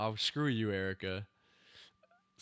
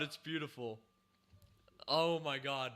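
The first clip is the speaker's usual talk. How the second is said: normal talking voice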